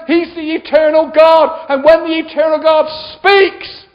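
high, sustained shouting sermon; full-force preaching. Real, not acted. Preacher commanding listeners to come out and live, shouted preaching. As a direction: thunder every phrase at the congregation.

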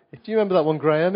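ordinary talk

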